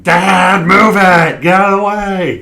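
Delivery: high, loud cartoon villain yell; Grinch shouting. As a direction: bellow like a runaway cartoon grump. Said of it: "Jim Carrey Grinch movie where he's just like".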